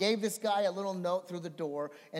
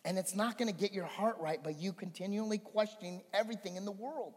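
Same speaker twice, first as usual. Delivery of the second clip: usual speaking voice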